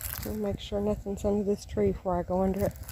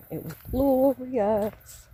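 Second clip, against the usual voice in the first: slow, higher, drawn-out delight; savouring; 5 semitones higher, 4 dB louder, much slower than usual. Real, not acted. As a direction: stretch it out with pleasure. Savouring her long sleep.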